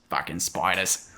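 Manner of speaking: low, slow Australian grumble; mock Australian accent. As an Australian cursing the wildlife, mock Australian accent. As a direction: curse them in fake Australian. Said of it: "Everything kills you in Australia".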